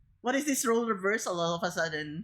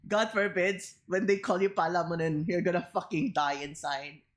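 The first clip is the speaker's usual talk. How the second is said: ordinary talk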